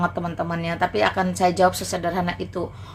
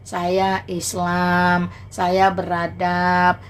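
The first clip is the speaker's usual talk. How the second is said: drawn-out, slow model answer; slow, patronising recital; slow mock politeness; much slower than usual. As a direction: spell it out slowly like to a child. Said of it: "Ngomong baik-baik".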